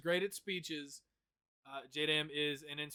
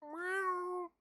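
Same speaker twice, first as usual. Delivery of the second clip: much higher, put-on meow; cat meow; 13 semitones higher, 4 dB quieter than usual. Shown as a demonstration. Performing meows for a friend on call, high cat meow. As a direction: do a cat's meow. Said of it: "you want more meows?".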